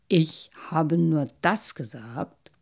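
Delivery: loud, emphatic carp with blubs; ponderous insistence on honesty; earnest protesting. A narrator's reading. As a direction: swear it weightily, bubbling between phrases. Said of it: "beteuerte der Karpfen".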